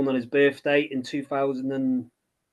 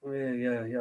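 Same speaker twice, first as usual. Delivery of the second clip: softer, shy mumbled yes; shyly; 4 semitones lower, 9 dB quieter than usual. Retold or imitated. Author shyly admitting to a villager, soft reply. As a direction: answer small and self-conscious. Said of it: "quite shyly".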